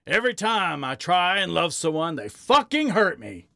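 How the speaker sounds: high, strained angry hurt; pained, frustrated outburst; growled. A narrator's reading. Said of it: "Harry growled".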